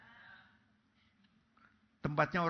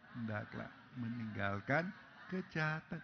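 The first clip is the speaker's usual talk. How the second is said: normal talking voice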